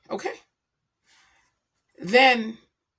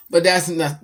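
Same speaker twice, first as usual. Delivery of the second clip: normal talking voice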